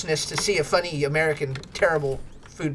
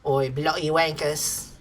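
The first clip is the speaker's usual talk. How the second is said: put-on slow, softer jokey British accent grumble; mock British accent; 6 semitones lower, 4 dB quieter, slower than usual. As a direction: drawl it like a fed-up Brit. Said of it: "he's British".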